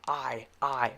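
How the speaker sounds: "I" as a wide "eye" glide; long I sound. Shown as a demonstration. Teacher showing the wider long I, isolated "eye". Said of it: "The long I sound is I".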